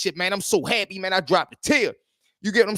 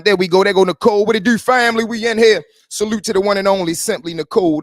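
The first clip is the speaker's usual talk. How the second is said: loud, higher, hyped welcome; excited shout-out; 3 semitones higher, 8 dB louder than usual. Real, not acted. Host hypes up viewer Nicole joining the stream, loud excited shout-out. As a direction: greet her like she just walked in the door.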